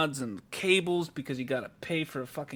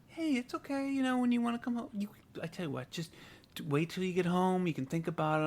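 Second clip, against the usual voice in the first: soft, higher-pitched imitation of soothing; very calm; 4 semitones higher, 6 dB quieter than usual. Partner soothes him on the phone, very calm. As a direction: talk someone down gently and quietly. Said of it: "very calm".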